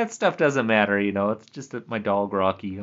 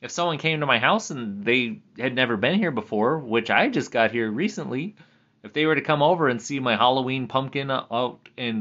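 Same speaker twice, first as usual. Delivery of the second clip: usual speaking voice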